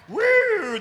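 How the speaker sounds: high, loud siren-like whoop; motorcycle noise. Retold or imitated. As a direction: imitate a bike roaring up. Imitates motorcycle pulling up.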